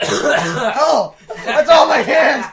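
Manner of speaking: slow, loud laughing yell; laughing shout. Real, not acted. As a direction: yell it out while cracking up. Reacts to a cough on his hands.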